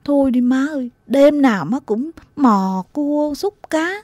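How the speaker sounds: put-on high, whining child's reproach; sulky nagging. Child reproaches mother back from night fishing, sulky high nagging. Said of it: "còn phụng phịu đay nghiến má".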